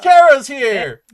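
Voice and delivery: loud, slow, drawn-out cheer; excited cry that Kara's here; excited announcement. Real, not acted. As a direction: sell it like a big reveal.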